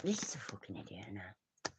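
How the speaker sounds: usual speaking voice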